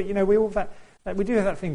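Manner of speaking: usual speaking voice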